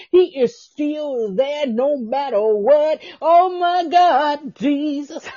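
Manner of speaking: rising, fervent preacher's call to the listeners; preaching. Real, not acted. Podcast host preaching to listeners about God's love, fervent sermon voice.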